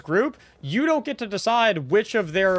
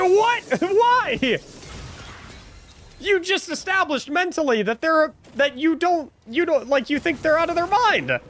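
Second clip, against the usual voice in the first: incredulous disbelief; 10 semitones higher than usual. Real, not acted. Laughing disbelief at bad raiders.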